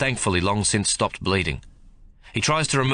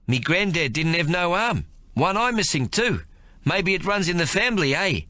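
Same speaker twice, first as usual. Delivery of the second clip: raised, lightly voiced Kiwi soldier making light of his wound; injured soldier jokes to his sergeant, raised voice; big Maori soldier, joking through pain; 10 semitones higher than usual. A narrator's reading. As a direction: play the wounded big man forcing a joke to stay calm.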